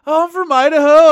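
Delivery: high, booming oblivious reply; imitated loud answer. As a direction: holler it cheerfully clueless, as the friend. Kyle's oblivious loud answer acted.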